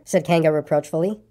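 plain reading voice

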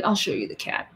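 ordinary delivery

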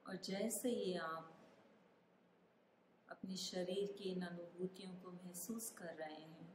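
calm meditation guide, unhurried with long pauses; guided meditation. Acted. Meditation guide asking listeners to feel their body, calm guided meditation. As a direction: ease the listener through a body scan, phrase by phrase.

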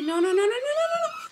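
high, rapid string of no's; panicked pleading. Real, not acted. Viewer fearing for the daughter, fast high panicked refusal. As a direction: rattle it out high and fast in dread. Dreading what happens on screen.

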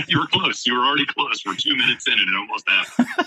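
high-pitched laughing admission, settling as it goes. Real, not acted. Guest admits to host he nearly cried, laughing. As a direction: laugh through the words, starting high and easing down.

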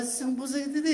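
put-on high, mumbled Portugal-accent gibberish; mock European Portuguese. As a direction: slur it high like caricatured Portuguese speakers. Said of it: "eles começam a falar".

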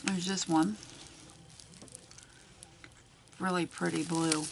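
talking while chewing gum. Real, not acted. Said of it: "Sorry, I got a piece of gum in my mouth".

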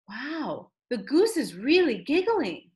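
ordinary delivery

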